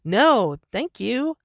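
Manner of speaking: high, fast, dismissive mock voice; mock brush-off. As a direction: shoo them away in a high, fast, bratty voice. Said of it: "hear myself saying".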